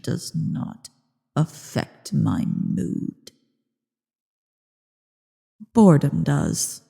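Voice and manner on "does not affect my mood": cold whisper. Acted. Dragon tells the mortal his plight means nothing, in a slow whisper.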